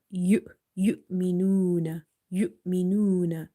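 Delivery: Yu'minuna with a stop after Yu; slow Yu'minuna, stop after Yu; sudden pause. Shown as a demonstration. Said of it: "Read it".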